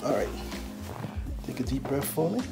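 ordinary talk, nothing marked

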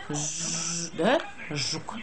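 ordinary talk

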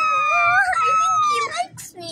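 very high, doting squeal; squeaky cooing. Acted. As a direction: melt over the puppy in a tiny high voice. Other doll coos at the puppy.

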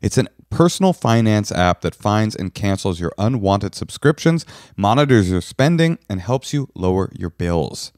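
upbeat sponsor read for the app. Acted. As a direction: sell the money app like a host ad.

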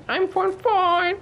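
very high, drawn-out silly voice; mock squeaky reply. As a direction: squeak out a comic answer, stretching the words. Answers game character in squeaky voice. (मज़ाकिया पतली, खिंची हुई आवाज़)

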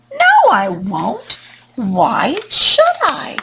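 slow, swooping storybook no; the water's haughty, stretched refusal; defiant refusal. Acted. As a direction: refuse with drawn-out words.

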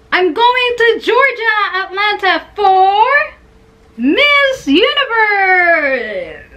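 high, loud squeal of good news; excited announcement. Real, not acted. Fan reveals she is going to Miss Universe, excited squeal. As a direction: belt it out, stretching the last words.

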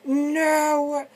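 drawn-out, high mock yell; imitated shout. Gamer showing how he lost his voice, imitated shout. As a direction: re-enact your own shouting, held back a little. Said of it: "my voice is fucking gone from just, like, shouting".

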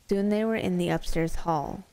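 plain reading voice

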